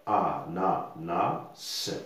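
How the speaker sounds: "A, na, na, se" in four even pieces; measured recap of "A, na, na, se"; syllable by syllable. Shown as a demonstration. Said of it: "Nous avons quatre syllabes".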